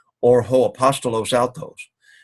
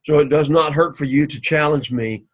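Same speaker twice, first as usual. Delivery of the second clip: ordinary talk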